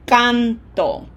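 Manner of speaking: canto drawn out slowly, weight on CAN; stress on first syllable. Shown as a demonstration. Teacher pointing out the emphasis, canto stressed on CAN. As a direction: lean on CAN in canto, falling on TO. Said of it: "Listen for that pronunciation emphasis".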